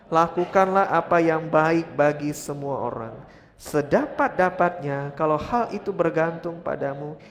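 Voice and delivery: loud, measured Bible reading; scripture read with force. Acted. Preacher leading the congregation in scripture, loud and measured. Reads scripture aloud together.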